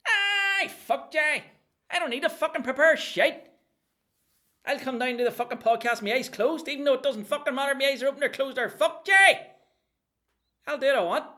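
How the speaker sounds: high, loud, ranting gaffer character; Decky ranting at the host about not preparing, high and loud. Acted. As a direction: blast it out high as a furious sweary gaffer. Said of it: "So, Decky".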